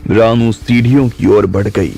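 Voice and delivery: usual speaking voice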